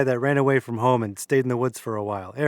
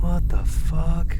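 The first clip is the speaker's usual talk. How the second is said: shocked; 4 semitones higher, 6 dB louder, much slower than usual. Acted. Shocked at the twitching body.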